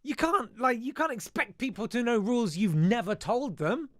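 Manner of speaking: high, loud, exasperated rant; real indignation, voice pitched right up; indignant protest. Real, not acted. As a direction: protest loudly at how unfair it is. Ranting in the pupils' defence.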